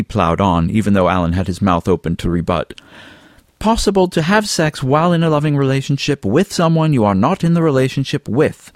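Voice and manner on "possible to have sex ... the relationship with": higher, emphatic lecturing; lightly voiced Fabian. A narrator's reading. Fabian lectures Alan on casual sex, higher and insistent. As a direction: plough on over any objection, pitched up.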